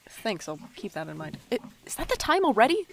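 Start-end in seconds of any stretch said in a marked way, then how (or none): 1.5-2.8 high, fast, loud panic; alarmed surprise. Acted. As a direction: suddenly realize you're late. Realizes late.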